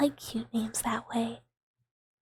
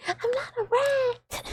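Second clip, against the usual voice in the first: much higher, slower, whimpering little creature; whiny pet voice; 12 semitones higher, slower than usual. Pretend. Streamer repeating the pet line as a slow, whimpering whine. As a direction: drag it out like a hurt little pet.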